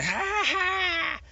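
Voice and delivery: high, slow cracking laugh; manic laugh. A narrator's reading. Captain laughing madly after firing, high and slow. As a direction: laugh on the edge of breaking. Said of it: "The captain laughed again".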